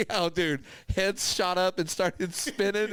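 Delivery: ordinary talk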